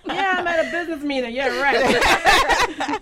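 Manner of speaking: high, loud laughing fake excuse; teasing a guest's party photos, laughing; mock quote, laughing. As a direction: laugh through the imitated excuse. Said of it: "she has pictures of people dancing".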